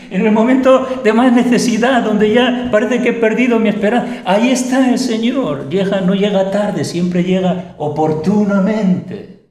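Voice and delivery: high, loud, rising sermon; fervent preaching. Acted. Preacher exhorting the congregation, high and loud with fervor. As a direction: preach it out with fire.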